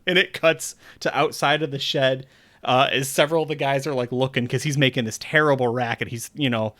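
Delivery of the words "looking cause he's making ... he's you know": ordinary talk, nothing marked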